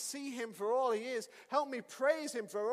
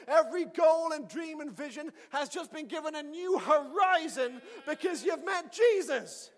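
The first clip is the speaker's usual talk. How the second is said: loud, much higher, fiery proclamation; preaching at full force; 7 semitones higher, 10 dB louder than usual. Acted. Preacher proclaims to the student that everything has changed. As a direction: preach it out with rising fire, slowing on the big lines. Preacher exults over Steve's encounter.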